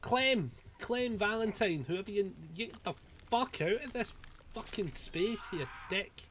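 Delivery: high, loud shocked outburst; startled yell. Real, not acted. Gamer startled by a teammate hiding nearby, yelling. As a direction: jump out of your skin.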